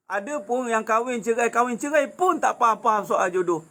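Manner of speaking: usual speaking voice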